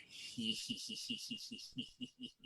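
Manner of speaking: whispered sinister he he he; eerie, soft threatening giggle; menacing prisoner giggle. Retold or imitated. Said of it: "they communicate with laughter".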